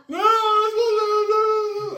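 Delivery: high, long-drawn wail in imitation of a boy; mock crying child. Scolded son answers his dad, mock wailing. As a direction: wail it like a kid bawling. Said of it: "he's crying and crying and crying".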